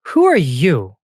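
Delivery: loud, puzzled fairy questioning a stranger. Acted. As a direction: demand it loudly in a light fairy voice. Fairy confronts the newcomer.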